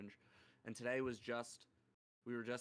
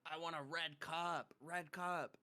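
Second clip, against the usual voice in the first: higher-pitched imitation of a child; demanding little girl; 4 semitones higher than usual. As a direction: mimic a kid demanding her cup. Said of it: "this other girl goes".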